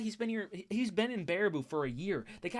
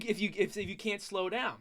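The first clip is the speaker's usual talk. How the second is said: ordinary delivery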